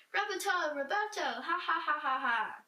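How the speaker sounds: forced laugh at his own pun; a jester's hammed-up ha-ha.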